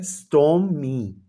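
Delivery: Stormy said slowly, split into its two syllables; syllables spelled out. Shown as a demonstration. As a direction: stretch stormy out syllable by syllable for the class. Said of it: "वो टू syllable word है". (Stormy को धीरे धीरे दो हिस्सों में बोलें)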